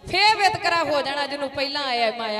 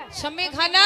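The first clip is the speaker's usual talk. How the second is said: louder call of the name; challenge call; 3 semitones higher, 5 dB louder than usual. A narrator's reading. Warrior calling his enemy by name, loudly. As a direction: call him out to fight. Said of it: "ਆਵਾਜ਼ ਮਾਰੀ ਹੈ".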